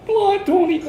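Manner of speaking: put-on high, light child's voice; voicing the small girl calling his name; little girl voice. Girl greeting the missionary, high child's voice. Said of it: "She goes".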